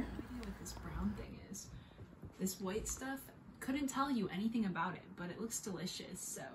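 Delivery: hushed whisper at the table. Real, not acted. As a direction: keep it to a soft whisper. Whispering at quiet dinner table.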